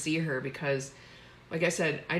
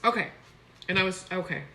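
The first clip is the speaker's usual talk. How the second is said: usual speaking voice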